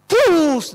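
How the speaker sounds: high, loud "pus" smash noise; explosive mimicked thud; imitated impact. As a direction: punch out the hit sound. Imitated sound of stone hitting head.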